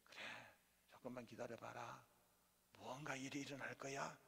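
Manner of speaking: very soft, patient promise; God consoling. Acted. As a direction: reassure in a hush, voicing God. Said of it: "그러면서 위로를 받고 살아가는 거죠". (조용히 다독이는 하나님 목소리)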